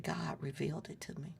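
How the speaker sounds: soft unvoiced whisper. The preacher telling her how she knew, whispered. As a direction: let it fall to a hush like a secret.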